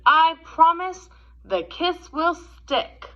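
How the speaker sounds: gentle, expressive storybook read-aloud; reading to children. A narrator's reading. Teacher reading The Kissing Hand to kids, gentle storytelling. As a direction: read it like a picture book at story time.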